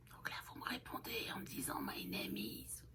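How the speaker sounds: hushed side note; whisper. Real, not acted. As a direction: whisper the instructions aside. Whispered instruction to viewers.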